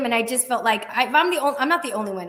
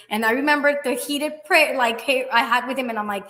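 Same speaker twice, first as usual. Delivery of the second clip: normal talking voice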